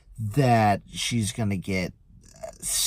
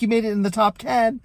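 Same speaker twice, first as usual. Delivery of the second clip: teasing joke; 12 semitones higher, 3 dB louder, faster than usual. Retold or imitated. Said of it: "I'm like".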